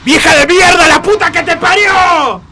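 shrill-pitched, full-volume street insult; outraged shout, high and loud; angry shouting. Acted. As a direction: yell abuse after someone in the street. Old lady in a shouted street quarrel.

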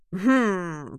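grumbled hum; a drawn-out grumpy hum; grumbling. A narrator's reading. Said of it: "пробурчал Генри".

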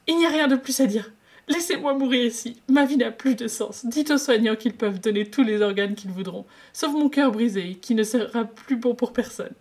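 high, loud theatrical lament; melodramatic despair. Acted. Wei Ying wails he wants to die, high and dramatic. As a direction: ham up a broken heart.

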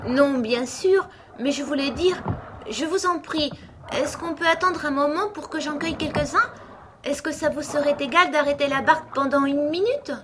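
put-on high-pitched, polite child's plea; pleading little girl. A narrator's reading. Alice asking to stop and pick rushes, pleading.

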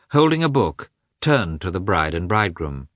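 plain reading voice

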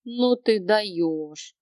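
plain reading voice

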